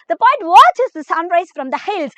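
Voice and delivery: very slow, word by word English reading; dictating to a class; slow note dictation. Acted. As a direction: read the notes out slowly for students to copy. Reads English notes aloud.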